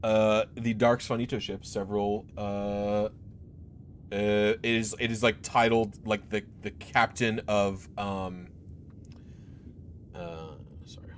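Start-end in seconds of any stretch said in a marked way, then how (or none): none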